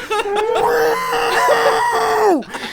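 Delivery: long, high, loud wordless squeal; horrified wail. Retold or imitated. Man realises he said stalking aloud, long horrified wail. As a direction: let out a drawn-out mock-horrified howl. Retold horror at own words.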